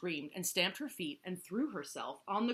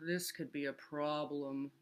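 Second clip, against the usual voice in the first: moaning. A narrator's reading. Walrus worries over Turtle's tantrum, moaning. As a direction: sigh it out as a moan. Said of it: "moaned Walrus".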